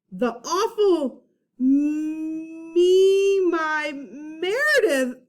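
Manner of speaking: mock wail. Narrator's dismayed cry.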